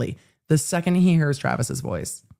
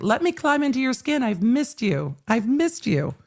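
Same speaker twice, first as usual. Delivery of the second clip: a hint of a high, needy cat voice; lightly voiced cat; 7 semitones higher than usual. Cat voicing love to its returning human, higher. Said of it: "That cat's like".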